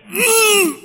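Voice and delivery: high-pitched held "mmm"; imitated pained grunt. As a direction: re-enact a stifled cry of pain. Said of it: "And I went".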